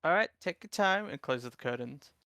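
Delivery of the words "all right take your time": higher-pitched, lightly voiced obliging reply; obliging shopkeeper. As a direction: lift the pitch to let a customer be. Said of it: "She's like".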